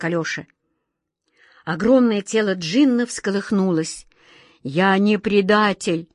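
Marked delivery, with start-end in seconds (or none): none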